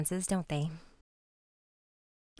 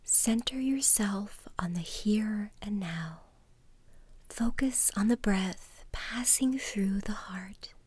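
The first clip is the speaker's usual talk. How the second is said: soft spa whisper; 5 dB quieter than usual. Acted. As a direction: whisper calmly, leading each breath. Guided mindfulness exercise in whisper.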